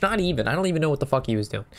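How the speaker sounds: normal talking voice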